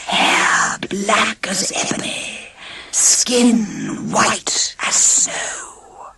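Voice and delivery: slow eerie whisper; magic mirror whispering the answer. A narrator's reading. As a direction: breathe it out like a ghost in glass. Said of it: "said the voice in the mirror".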